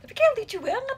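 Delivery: shrieking tapi kayak lucu banget; high squeal. Real, not acted. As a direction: squeal it in delight. Squealing over something cute.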